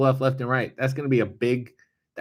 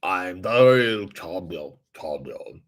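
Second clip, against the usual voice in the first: low, slow French giant voice; clumsy slow French giant impression, fading off; Andre the Giant; 4 semitones lower, slower than usual. Shown as a demonstration. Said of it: "so much going on with that voice".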